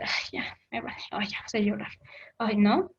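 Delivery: mock-careless, hushed and breathy; dismissive whisper. Indifferent aggressor mocking the hurt person, dismissive whisper. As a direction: brush the hurt off with a bored whisper.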